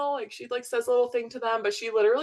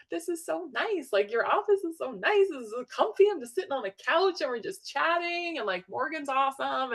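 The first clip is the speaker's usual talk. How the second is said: higher, bubbly gushing over the cozy office; gushing delight; 6 semitones higher than usual. Retold or imitated. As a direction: gush like a thrilled first-time visitor. Gushing to the midwife at first visit.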